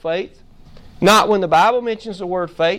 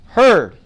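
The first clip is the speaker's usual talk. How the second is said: Heard punched loud; stress on Heard; 7 dB louder than usual. Real, not acted. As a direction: hit the word Heard. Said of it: "I was emphasizing that word".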